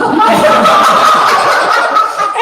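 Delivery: breathy, high, loud laugh; real burst of laughter. Real, not acted. Laughing at the guide's joke about the boat, a loud breathy laugh.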